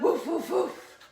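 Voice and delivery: playful imitation barking; puppy woofs. Shown as a demonstration. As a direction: bark like an eager puppy. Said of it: "what kind of sounds does your puppy make".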